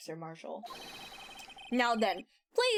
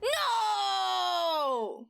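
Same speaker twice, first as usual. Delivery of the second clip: shouting; 7 semitones higher than usual. Acted. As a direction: yell it out. Dramatic objection shout.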